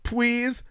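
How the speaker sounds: high, soft "Please" with a w for the l, "pwease"; mouse voice. A narrator's reading. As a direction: squeak "Please" like a pleading little mouse, "pwease". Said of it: "irresistible mouse voice".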